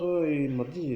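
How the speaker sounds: sudden indignant near-shout; loud outburst. Real, not acted. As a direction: blurt it out at full volume. Ranting about his doctor.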